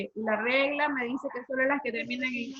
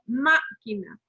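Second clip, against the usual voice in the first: "máquina" with MÁ punched and set apart; stress on first syllable; 3 semitones lower, 3 dB louder, slower than usual. Shown as a demonstration. Teacher sounding out máquina for the class, stress on first syllable of "máquina". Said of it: "es una palabra esdrújula".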